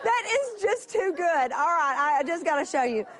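overjoyed, can barely hold it in; laughing delight. Real, not acted. Preacher to congregation about holy charades, laughing with joy.

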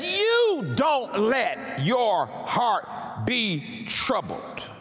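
"You" high and drawn out, then each word slow and separate; stress on YOU. Retold or imitated. Preacher driving the verse home to listeners, emphatic "You". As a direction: hammer "You", then space out every word. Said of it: "implied, uh, word here is you".

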